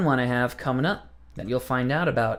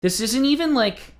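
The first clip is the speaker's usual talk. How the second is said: high, exasperated outburst; 10 semitones higher, 3 dB louder than usual. Real, not acted. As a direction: pitch up in disbelief. Exasperated rant about ride.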